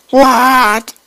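very high, loud outburst of disbelief; shocked shriek. Retold or imitated. Mom hearing the baby ate a worm, shocked shriek. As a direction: shriek it in mock horror.

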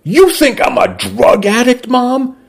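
high-pitched, incredulous question to mom; incredulous teenage son. A narrator's reading. Son challenges mother over rehab clinic.

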